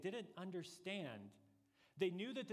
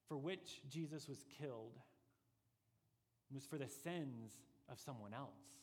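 usual speaking voice

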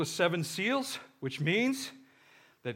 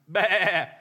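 lamb bleat; 5 dB louder than usual. Retold or imitated. Imitating a lamb.

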